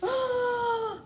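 sharp high gasp of surprise; theatrical storytime gasp; big gasp. Acted. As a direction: gasp as if shocked for the kids.

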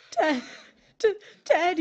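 high, loud, stuttering; frightened stammer. Acted. Widow stammering at her revived husband, frightened. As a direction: stammer in disbelief.

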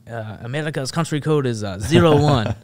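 put-on loud French-accented punchline. French reporter giving up the phone and country code, in a French accent. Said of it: "I can do a French accent".

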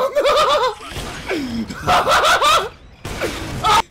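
high, loud cackling; helpless laughing fit. Real, not acted. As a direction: laugh until out of words. Cracking up at teammate.

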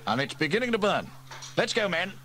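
rally the men fast; urgent chief. Acted. Fire chief orders his men out, urgently.

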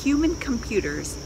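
ordinary delivery

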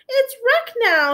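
high, slow upset complaint; high distressed whine. Acted. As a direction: moan about the wrecked tower. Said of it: "said Pteranodon".

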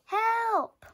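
a small voice crying out in danger; cry for help. A narrator's reading. Trapped mice calling for help, frightened. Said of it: "picked up a faint cry".

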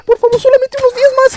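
high, fast whiny begging; mock pleading. Youtuber begging YouTube for mercy, high whiny plea. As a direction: beg like a whining kid.

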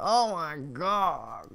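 drawn-out, high-pitched acted horror; mock shock. Storyteller as neighbour shown the bowl, mock horrified. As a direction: stretch it out high, playing appalled.

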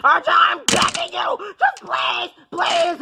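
high, slow, tearful pleading; hammed-up tearful begging. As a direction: draw out a desperate plea. I'm begging you.

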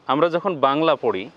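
ordinary delivery

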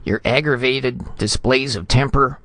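high, formal scolding, building; severe reprimand. A narrator's reading. Ambassador formally reprimanding vice consul Retief, severe. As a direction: pompously reel off the list of offences. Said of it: "looked severely".

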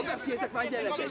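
normal talking voice

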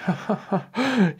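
high, mocking storybook chuckle; king's laugh. A narrator's reading. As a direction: laugh in short bursts as the king. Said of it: "The king laughed".

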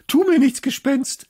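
high, loud, panicked begging; terrified plea. A narrator's reading. A girl begs the ghost at her door to leave her alone, terrified plea. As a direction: beg the ghost to spare you. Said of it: "schrie ich wieder".

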